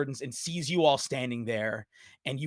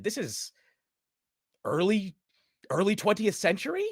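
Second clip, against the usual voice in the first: ordinary delivery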